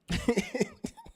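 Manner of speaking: real laugh breaking out; burst of laughter. Real, not acted. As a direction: crack up high at what was read. Laughing at the texts.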